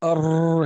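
rolled R held out in "أر"; trilled ra. Shown as a demonstration. Teacher tries the letter for students, trilled ra. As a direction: roll the ر of "أر" on the tongue tip. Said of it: "نجربها".

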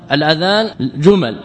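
ordinary talk, nothing marked

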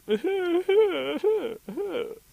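very high, loud, broken sobbing cries; imitated wailing. Deer showing bear the noise, high imitated wailing. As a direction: wail in repeated high bursts. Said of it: "It sounds like this".

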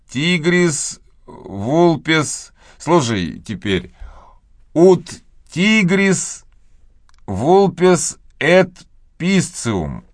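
slow, careful schoolboy recitation. A narrator's reading. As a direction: recite each Latin word slowly. Tom reciting Latin.

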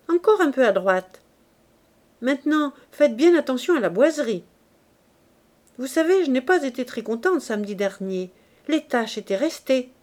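a hint of a smug mistress giving orders; lightly voiced Virginie. Virginie directing the scrubbing. (Virginie donne des ordres, voix un peu plus aiguë et forte)

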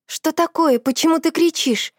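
high, surprised asking; ask, startled and puzzled; surprised question. A narrator's reading. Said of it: "удивлённо спросила подруга".

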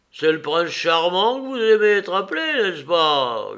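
high, loud taunting yelp. A narrator's reading. Woman calling behind the departing man, yelping. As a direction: yelp it after him, climbing high then falling. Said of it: "glapit-elle derrière lui".

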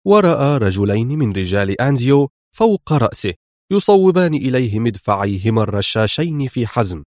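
ordinary delivery, nothing marked

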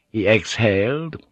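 plain reading voice

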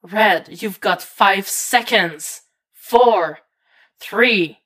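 tense, rising urgency; urgent countdown. A narrator's reading. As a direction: warn them as time runs out. Oracle counting down to an explosion.

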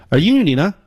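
ordinary talk

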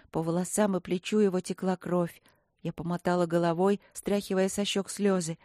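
plain reading voice

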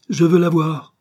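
high, loud peremptory demand; insistent demand. A narrator's reading. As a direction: demand it forcefully, cutting off all explanation. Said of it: "exigeait la Corse". (exigence impérieuse, voix haute et forte)

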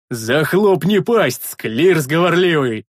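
slow, loud outraged roar; indignant yell. A narrator's reading. Big man silencing a sentry, indignant yell. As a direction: bellow back in indignation. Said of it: "возмущённо заорал в ответ Рикар".

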